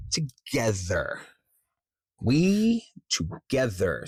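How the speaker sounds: slow, stretched emphasis on "we" and "together"; stress on WE, TOGETHER. Shown as a demonstration. Coach isolates the key words of a script, slow stress on "we" and "together". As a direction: draw out "we" and "together" slowly. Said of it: "See how it emphasizes that sentence".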